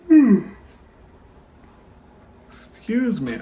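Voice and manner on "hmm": long, high, loud yawning hum; a real sleepy yawn. Real, not acted. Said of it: "I just yawned".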